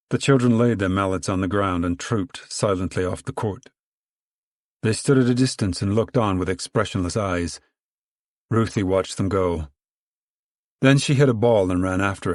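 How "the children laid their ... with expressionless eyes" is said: plain reading voice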